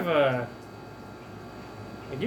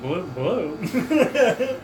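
lightly voiced gurgle underwater; gurgling fish man croak; 5 semitones higher than usual. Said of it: "He's like".

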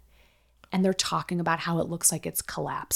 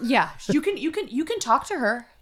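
mocking high voice; 7 semitones higher than usual. Retelling the mother's cold reply.

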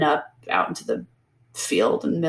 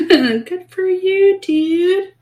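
much higher, slow, sing-song praise; mock congratulation; 13 semitones higher, 5 dB louder, much slower than usual. Real, not acted. Ridiculing the hero's minor wound, high mock praise.

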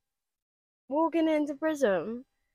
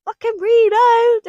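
rave rewind cry; 13 semitones higher, 12 dB louder than usual. Retold or imitated. Raver at a drop, high excited yell for reload. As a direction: scream for the DJ to pull it. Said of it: "you're like".

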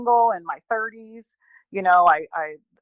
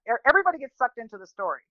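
ordinary talk

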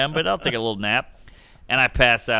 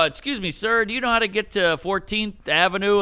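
higher mock polite question; polite asking; 8 semitones higher than usual. Comic imitating himself asking a stranger for directions, higher and polite. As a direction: sweetly ask directions. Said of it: "I would go".

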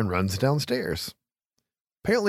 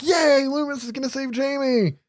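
high-pitched hopeful cheer, hammed up; mock cheering; 13 semitones higher than usual. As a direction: cheer like a relieved audience, over the top. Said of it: "you're like".

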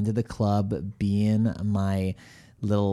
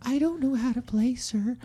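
very high, innocent little voice; feigned innocence; 13 semitones higher, 4 dB quieter than usual. Acted. Pool shark fooling an opponent, feigned innocence. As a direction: play the helpless beginner, high and sweet. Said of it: "I'm kind of a pool shark".

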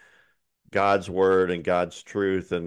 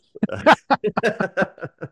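a burst of high-pitched laughter; 12 semitones higher, 4 dB louder than usual. Real, not acted. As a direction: laugh out loud, high and bright. Laughs at his own joke.

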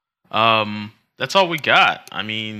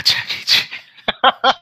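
normal talking voice

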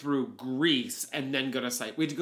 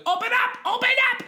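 imitated high, loud shout; door-banging shout; 7 dB louder, faster than usual. Testers banging on hotel doors, high loud mock shout. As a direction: holler like officials banging on doors. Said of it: "banging on doors and going".

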